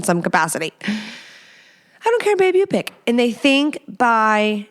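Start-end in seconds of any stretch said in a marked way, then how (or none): 2.1-2.9 high-pitched dismissive imitation; mocking, higher-voiced husband; mock indecisive husband. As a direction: imitate a passive partner in a raised pitch.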